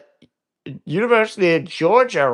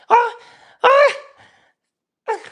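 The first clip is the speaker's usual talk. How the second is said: fake sobbing; 5 dB louder than usual. Mock fake crying.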